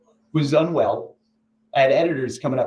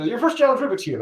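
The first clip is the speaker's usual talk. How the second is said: announcer; 9 semitones higher than usual. Retold or imitated. Announcing challenge, voiced.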